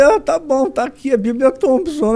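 high, loud mock-grudging "tá bom"; a hint of forced generosity; reluctant giving. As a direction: give it up through clenched reluctance. Grudgingly handing over prized Bible.

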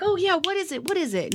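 high, flustered mock uncle; uncle fumbling. Uncle tries to recall her name, high flustered voice. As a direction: play the uncle groping for the name. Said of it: "And he was like".

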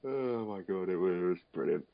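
ordinary delivery

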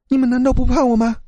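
high, fast, sharp ghost voice; a touch of angry ghostly force; stern ghost demand. A narrator's reading. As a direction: snap it at them, trying to frighten. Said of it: "女人生气地厉声说道".

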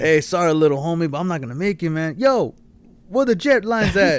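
high, loud imitation of a TV character; acted show character. Re-enacting the show's brush-off for a friend, high and loud. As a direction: call it out high and loud, playing the part.

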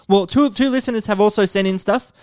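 normal talking voice

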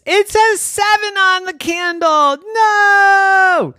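high, loud outraged no; angry child shout. A narrator's reading. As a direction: explode at the candle. Girl angry at candle.

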